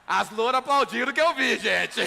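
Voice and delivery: loud mock outcry to the crowd; playful loud accusation; teasing shout. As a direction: call it out loud, ribbing the audience. Mock outburst teasing the audience.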